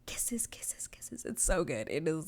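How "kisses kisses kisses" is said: hushed, rapid little whisper of delight; gleeful whisper. Real, not acted. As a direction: whisper it like a delicious secret. Gushing over a favorite scene.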